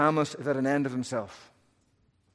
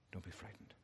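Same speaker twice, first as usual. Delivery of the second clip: even softer, nearly breathed out; fading to a whisper; 4 semitones lower, 21 dB quieter, faster than usual. Acted. God repeating comfort to Jeremiah, dying away to near silence.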